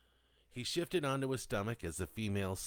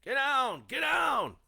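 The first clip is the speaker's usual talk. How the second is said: guardsmen's high, loud command; shouting; 9 dB louder, slower than usual. A narrator's reading. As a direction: shout an urgent warning across the field. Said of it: "the guardsmen were shouting".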